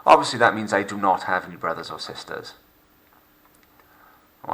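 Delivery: ordinary delivery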